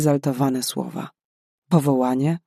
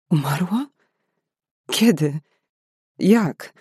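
shocked questions; 3 semitones higher than usual. A narrator's reading. Shocked at aunt's news.

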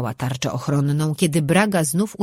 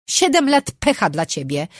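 shouting; 6 semitones higher, 4 dB louder than usual. A narrator's reading. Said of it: "krzyknęła dziewczyna".

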